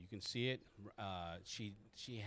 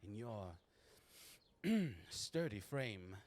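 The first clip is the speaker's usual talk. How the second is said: lilting, higher woman's voice; genteel haberdasher madam; 5 semitones higher than usual. Acted. Marjorie receiving a customer in her haberdashery, genteel and lilting. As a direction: scold the girls, then politely welcome the customer.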